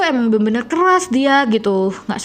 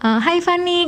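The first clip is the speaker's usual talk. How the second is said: coworker greeting; 7 semitones higher than usual. Retold or imitated. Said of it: "orang kalau nyapa gue".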